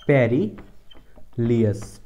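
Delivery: पेरिलियस split as "पेरी", pause, "लियस"; slow broken dictation of पेरिलियस; sounded out in parts. Shown as a demonstration. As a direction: say पेरिलियस very slowly in two chunks. Teacher sounding out new word.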